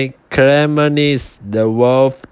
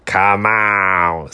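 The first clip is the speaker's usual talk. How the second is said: werewolf; 4 semitones lower than usual. Acted. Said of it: "said the wolf, with his mouth watering".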